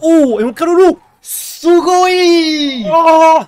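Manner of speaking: squealing high and loud, anime-style; excited anime fan. Real, not acted. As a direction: shriek "Sugoi" like a hyped otaku. Card combo pops off. (fan d'anime surexcité)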